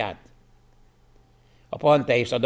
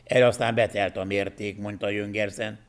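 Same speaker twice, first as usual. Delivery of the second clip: ordinary talk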